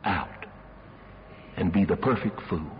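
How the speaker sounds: a preacher's final grave plea to the congregation; solemn warning. Real, not acted. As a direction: close the sermon with a weighty warning.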